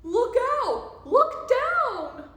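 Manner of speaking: high, loud warning yell; storybook shout of danger. A narrator's reading. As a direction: yell it as an urgent alarm. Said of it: "who yelled".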